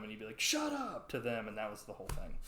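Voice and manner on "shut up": high-pitched retold yell without extra volume; mock yell. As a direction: imitate someone yelling back at a heckler. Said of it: "somebody would yell at him".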